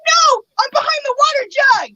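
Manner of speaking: hiding and scared, pushing the words out; frightened answer. Retold or imitated. Hiding from the intruder.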